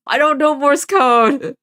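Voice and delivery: high, loud, slow panic; alarmed cry of helplessness; panicked. Real, not acted. Panicking at Morse code.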